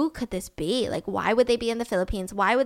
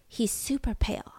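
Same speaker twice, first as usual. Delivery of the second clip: soft, half-whispered revelation; hushed; 7 dB quieter than usual. Real, not acted. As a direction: drop to a near whisper for a grim detail. Hushed shocking detail.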